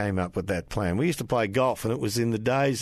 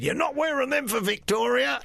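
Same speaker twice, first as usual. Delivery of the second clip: higher half-laughing imitated yell; mock yell. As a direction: bark it at him with a laugh breaking through. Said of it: "he yelled at me".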